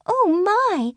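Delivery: a startled fairy gasping oh my; fairy cry. Acted. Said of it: "cried Rainbow Frost".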